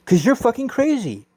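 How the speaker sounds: high-pitched teasing blurt; teasing outburst. Real, not acted. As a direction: blurt it out at him. Another speaker teasing.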